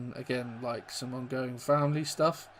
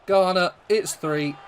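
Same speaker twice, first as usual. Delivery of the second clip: loud, higher, stretched-out goal call; goal celebration; 5 semitones higher, 7 dB louder, slower than usual. Real, not acted. Calling a goal as it happens.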